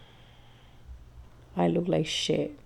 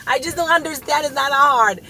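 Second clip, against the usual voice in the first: exasperated rant; 8 semitones higher, 11 dB louder than usual. Real, not acted. Ranting about bad drivers.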